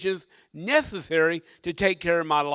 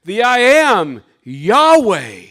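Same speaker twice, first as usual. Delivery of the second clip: booming declaration as God; very loud, forceful proclamation; shouted back; 3 semitones higher, 12 dB louder, slower than usual. Acted. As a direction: thunder out the divine name. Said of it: "God shouted back or spoke back".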